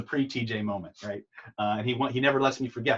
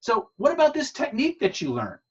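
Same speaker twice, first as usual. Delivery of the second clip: higher, imitating a curious girlfriend; 10 semitones higher, 5 dB louder than usual. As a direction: lift the voice up into her curious question. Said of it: "she looks at him and she goes".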